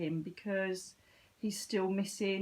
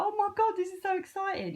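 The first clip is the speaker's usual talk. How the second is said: much higher and louder, thrilled; squealing with excitement; excited gush; 12 semitones higher, 5 dB louder than usual. Retold or imitated. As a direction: gush like a delighted group member. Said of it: "They were like".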